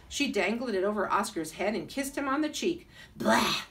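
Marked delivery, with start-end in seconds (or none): 3.2-3.6 loud disgusted blah; a kid yuck at a kiss. Acted. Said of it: "said Oscar".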